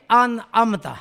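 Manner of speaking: انعمت with a plain glottal a in the middle; mistaken hamza. Shown as a demonstration. Teacher showing the common error, plain a in انعمت. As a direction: say انعمت quickly, no throat ain. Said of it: "یہاں غلطی ہوتی ہے".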